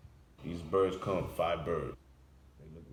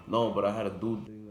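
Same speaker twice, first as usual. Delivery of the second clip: mock angry friend; 4 semitones higher, 8 dB louder than usual. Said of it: "He, he lost it".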